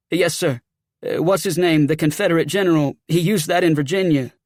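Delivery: agitated Southern soldier; rattled sergeant. Acted.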